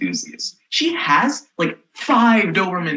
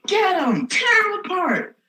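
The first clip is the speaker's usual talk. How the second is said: high mock attack command; 13 semitones higher than usual. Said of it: "she's like".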